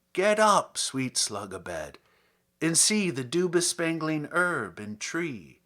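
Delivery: measured, lyrical poetry reading; poem reading. Acted. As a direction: read it like verse for a listener. Reads a Herrick poem aloud.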